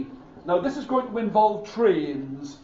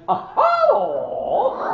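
little man voice; 10 semitones higher, 4 dB louder than usual. Acted. Little man greets youngest boy.

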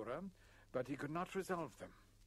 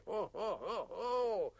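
high, loud ho-ho laughter; booming theatrical belly laugh; hearty laugh; 7 semitones higher, 5 dB louder, slower than usual. A narrator's reading. Father overjoyed at his newborn son, hearty laughing. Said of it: "suddenly began laughing like a calf".

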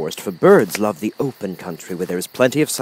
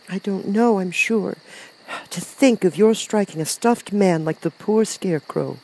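put-on high-pitched reproving little girl; Dorothy, reproachful; 9 semitones higher than usual. A narrator's reading. Dorothy scolds lion.

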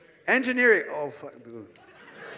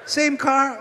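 incredulous repeat; 8 semitones higher, 4 dB louder than usual. Real, not acted. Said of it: "Did you take separate cars".

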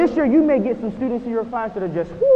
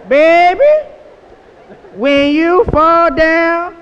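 slow, louder grandma's call; 7 semitones higher, 10 dB louder, much slower than usual. Retold or imitated. Speaker demonstrates the grandma voice to the crowd, slow. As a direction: draw it out like a loving grandma. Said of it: "You gotta do the grandma voice".

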